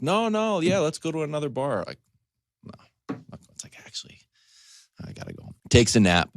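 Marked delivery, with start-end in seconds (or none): 0.0-1.8 high, forced eager voice; fake enthusiasm. Tired adult to friends, faking eagerness for another bar. Adult pretending to want to stay out. 2.6-5.5 breathy whisper, barely there; whispered excuse. Retold or imitated. Tired adult to friends, whispering an exit.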